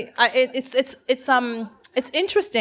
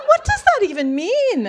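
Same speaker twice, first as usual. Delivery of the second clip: high, loud mock bafflement; baffled disbelief; 14 semitones higher, 7 dB louder than usual. Mum retelling her reply to odd slang, baffled disbelief. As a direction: exclaim in comic incomprehension, laughing.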